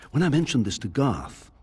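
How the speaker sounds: ordinary talk, nothing marked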